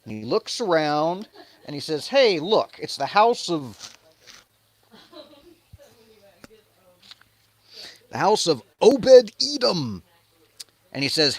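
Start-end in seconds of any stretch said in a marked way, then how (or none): 2.1-10.0 louder, higher excited king; loud mock-cheerful David; hammed-up King David. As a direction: call out like a delighted king. David voiced in retelling.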